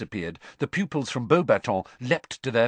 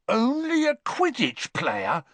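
incredulous; 13 semitones higher, slower than usual. Acted. Boy outraged by friend's dismissal.

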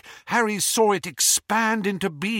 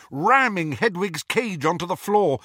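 ordinary delivery, nothing marked

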